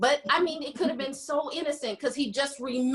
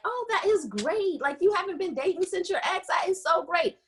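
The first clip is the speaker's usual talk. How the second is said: higher, fake-cheerful praise; playing it off; 8 semitones higher than usual. Friend covering her jealousy with higher fake cheer. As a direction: gush over it to hide the sting. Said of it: "We played it off like".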